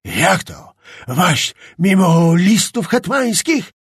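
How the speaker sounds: choking with rage, words slow and broken off; furious sputtering. A narrator's reading. Czapliński sputters at Skrzetuski in a rage, broken furious shouting. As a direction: spit out each word too angry to finish. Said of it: "puszczał tylko urywane wyrazy".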